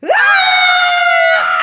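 high shrieking scream. Acted. Leo screams at the figure in the trees. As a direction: let out a terrified shriek. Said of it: "Leo screamed".

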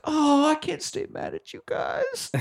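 very high, soft, slow coo; mock sweet forgiveness. Santa softening at a note, high mock cooing. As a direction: coo it slowly in a tiny voice.

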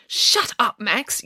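high-pitched, restrained yell; yelled rebuke. A narrator's reading. Embarrassed schoolgirl silences the boy, mild yell. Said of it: "yelled Lizzie".

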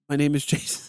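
mock an actor who won't move his face; Jason Statham. Co-host mocking the star's motionless face, stiff impression. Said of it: "Homie kept his face like".

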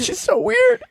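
very high squeaky mimic; exaggerated high falsetto parody of a castmate; squeaky mock voice.

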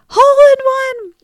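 put-on high, loud victory cry of a boy; excited kid shout. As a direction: shout it out, thrilled. Son calls out his golf success.

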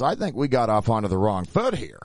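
usual speaking voice

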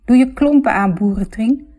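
mildly hammed-up name-calling; playful insult. A narrator's reading. Sisters trade playful insults.

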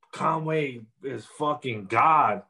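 drawn out slowly, word by word; slow, reverent declaration; slow emphatic quote. Retold or imitated. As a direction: lay each word down slowly like a proclamation. Quoting what people were saying.

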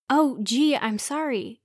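plain reading voice

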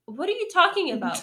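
high, puzzled what are you talking about; confused. Retold or imitated. To her coworker, baffled. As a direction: sound baffled and caught off guard. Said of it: "I was like".

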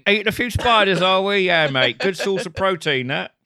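high, loud, hammed-up Cockney banter; Cockney accent. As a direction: join in as a matey Cockney. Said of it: "It's too much Cockney".

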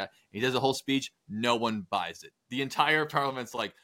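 ordinary delivery

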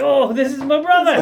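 high, loud character voice with laughter; Honest Tom. Acted. Tom introducing his brother to the crew, loud and high. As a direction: play it loud, high and silly. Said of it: "And Honest Tom's like".

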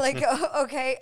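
laughing. Real, not acted. Laughing at own reaction.

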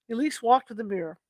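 plain reading voice

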